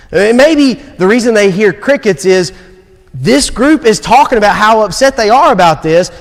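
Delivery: preaching to the congregation, urging them to act; preaching at full force. Acted. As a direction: challenge the church with urgency.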